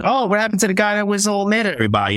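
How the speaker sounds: high, fast teasing put-on voice, ribbing a friend; mock teasing. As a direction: needle him in a silly high voice. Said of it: "we were busting his balls like".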